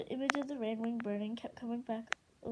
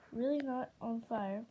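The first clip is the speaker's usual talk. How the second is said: plain reading voice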